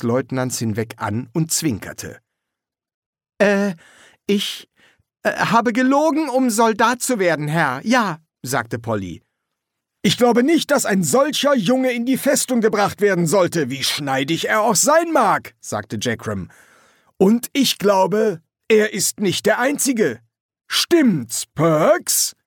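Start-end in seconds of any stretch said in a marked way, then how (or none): 3.4-8.2 high, halting recruit's voice; stammering confession. Acted. Polly stammelt erzwungenes Geständnis. 10.0-15.5 loud, high, projected sergeant's voice; forceful sergeant Jackram. Acted. Feldwebel Jackram redet zum Leutnant. 17.2-22.3 loud, high, projected sergeant's voice; forceful sergeant Jackram. Acted. Feldwebel setzt nach.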